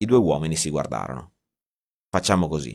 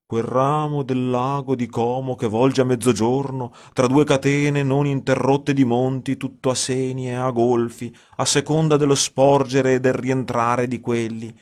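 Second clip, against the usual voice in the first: 1 mock solemn reading; 6 semitones higher than usual. A narrator's reading. Editor reads Manzoni aloud.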